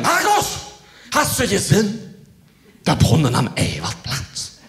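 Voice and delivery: high, excited calling out; excited friend. Retold or imitated. Friend runs up excited about the fountain. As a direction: gush it breathlessly excited. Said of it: "aufgeregt wie sonst was, schreit mich an".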